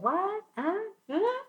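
high, baffled "what? huh?"; confused husband. Retold or imitated. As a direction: play the husband who can't follow the Spanish, pitching up. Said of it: "oh, he said, like".

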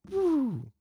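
a quick high woo for a zip away; whoosh woo. Retold or imitated. Sue turns invisible and zips off, high playful woo. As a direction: swoop the voice up like something vanishing.

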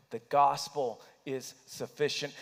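ordinary talk, nothing marked

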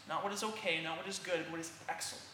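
normal talking voice

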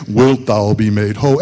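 mock-pious deep scripture reading; religious King James voice. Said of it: "Ain't nobody talking like that".

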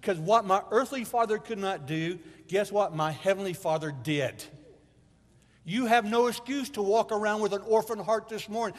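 high and loud, climbing with conviction; full-force preaching. Acted. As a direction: shout the good news to the church.

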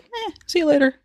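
high, careless brush-off; dismissive. Retold or imitated. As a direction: toss it off lightly. Xena voiced, dismissive.

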